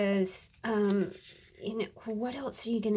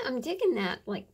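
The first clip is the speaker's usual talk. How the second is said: usual speaking voice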